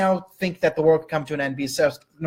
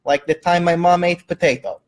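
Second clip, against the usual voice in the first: a light mock Russian accent, slowed. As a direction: cohost thanks a viewer in a jokey Russian accent. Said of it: "liking Russian impersonation".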